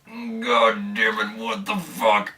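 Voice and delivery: slow then a high exasperated cry; frustrated. Retold or imitated. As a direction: drag it out, then squeak in dismay. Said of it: "I'm like".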